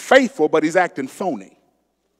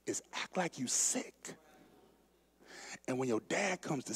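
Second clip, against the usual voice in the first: crafty Jonadab; 4 semitones higher, 11 dB quieter than usual. Retold or imitated. Cousin whispering a sneaky plan to Amnon, soft and sly. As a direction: lean in and conspire softly. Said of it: "Jonadab says".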